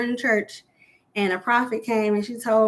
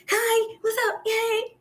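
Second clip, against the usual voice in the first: much higher, bubbly mock greeting; a perky coworker's hello, done high and bright; imitating a sweet coworker; 11 semitones higher, slower than usual. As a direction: chirp it like the nicest girl at work. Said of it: "She's just so sweet".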